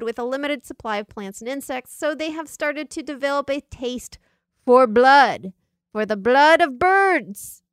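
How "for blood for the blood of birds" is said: dramatic emphasis. Acted. Dramatic reveal, louder.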